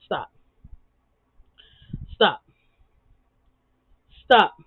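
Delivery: stop, stop, STOP; building stop. Pretend. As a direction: escalate the command.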